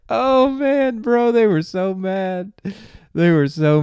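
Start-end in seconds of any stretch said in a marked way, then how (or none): none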